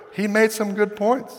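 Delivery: high-pitched meek excuse, rising at the end; mock-timid underling answering the boss; sheepish guard. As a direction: offer a small, high, defensive excuse.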